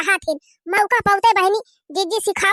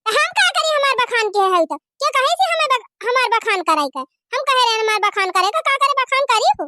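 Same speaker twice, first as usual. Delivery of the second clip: angry retort; 4 semitones higher than usual. Acted. Daughter-in-law quarrels loudly.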